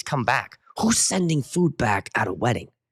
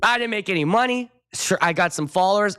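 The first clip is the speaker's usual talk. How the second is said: usual speaking voice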